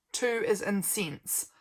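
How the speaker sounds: normal talking voice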